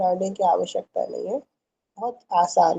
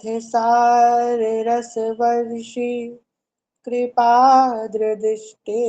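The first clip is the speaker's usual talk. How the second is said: slow verse recitation; much slower than usual. Shown as a demonstration. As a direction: chant the Sanskrit shloka slowly and evenly. Chanting the verse.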